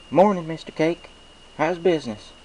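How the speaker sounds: cheerful country pony saying hello; Applejack's voice. A narrator's reading. Applejack greets the baker at the counter, cheerful.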